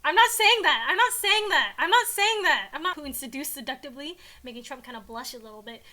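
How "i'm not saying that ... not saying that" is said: high, loud repeated protest; flustered refusal. Real, not acted. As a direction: refuse emphatically, over and over. Reader refuses to read the line.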